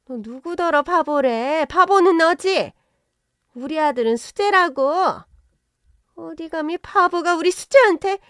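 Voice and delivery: high, shrill-free furious scolding; indignant outrage. Acted. Mother-in-law defending her son against the daughter, high indignant scolding. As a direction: snap back in mounting outrage.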